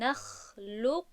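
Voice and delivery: slow «نخلقکم» keeping the heavy back quality of qaf; partial merging. Shown as a demonstration. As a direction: hold a heavy qaf closure, then open into kaf in «نخلقکم». («نخلقکم» با ادغام ناقص، صفت استعلای قاف باقی)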